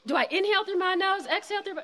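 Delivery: mock muddled self-questioning; confused beginner. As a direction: act out someone unsure of the steps. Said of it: "some people can't remember".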